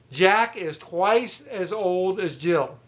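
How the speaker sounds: slow, deliberate, each word spelled out; slow emphatic teaching. Shown as a demonstration. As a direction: dictate it slowly to the class.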